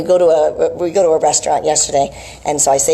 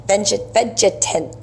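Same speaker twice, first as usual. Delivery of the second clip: tripping over the word and breaking into a laugh; tongue-tied laugh; 4 semitones higher, much slower than usual. Real, not acted. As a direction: laugh at your own stumble.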